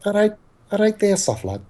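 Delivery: put-on Scouse accent starting high and falling away; put-on Scouse greeting; imitated Liverpool accent. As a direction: copy someone's Liverpool accent demo. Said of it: "that was me imitating you".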